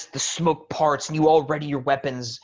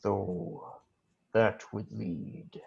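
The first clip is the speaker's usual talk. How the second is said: menacing whisper; 2 semitones lower, 10 dB quieter than usual. Acted. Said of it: "And he says".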